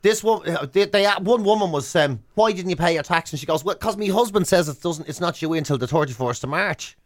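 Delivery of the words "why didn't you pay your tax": ordinary talk, nothing marked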